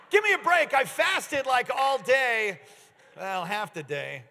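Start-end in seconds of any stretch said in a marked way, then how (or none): 0.1-2.6 mock complaint. Real, not acted. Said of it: "joking about his fasting".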